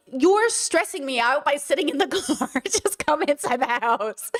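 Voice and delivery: pleading through a laugh, slowing at the end; laughing plea. Real, not acted. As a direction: laugh as you coax her inside.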